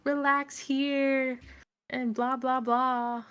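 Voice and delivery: lightly voiced smooth-talker; mock soothing. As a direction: placate her dismissively. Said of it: "He's like".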